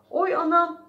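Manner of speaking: high, loud yelp; sudden yell. A narrator's reading. Mouse jumping up from the ground, sudden pained yell. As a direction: cry out in sudden pain. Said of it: "diye bağırarak birden".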